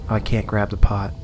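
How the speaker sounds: ordinary delivery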